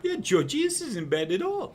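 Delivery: high, squeaky pleased voice; a tiny mouse savoring stolen milk; Ralphie mouse. Acted. Mouse answers while drinking milk.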